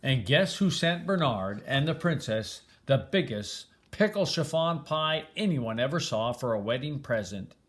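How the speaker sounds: plain reading voice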